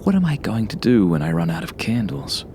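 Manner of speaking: usual speaking voice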